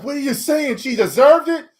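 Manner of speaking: high, indignant woman's voice; high and outraged; Catwoman voice. Retold or imitated. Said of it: "she was like".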